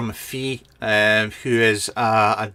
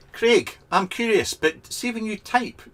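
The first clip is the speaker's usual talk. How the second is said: mocking Scotsman; 10 semitones higher than usual. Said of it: "taking the piss out of my fellow Scotsmen".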